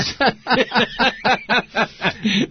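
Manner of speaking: high, loud burst of laughter; real laugh. Real, not acted. Actor amused at his leg stuck out, loud laughing.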